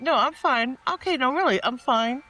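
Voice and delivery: high, forced "I'm fine" over rising panic; mock cheerful denial. Imitating the meme dog with its house on fire, forced cheerful denial. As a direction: insist you're fine while the house burns. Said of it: "they're like".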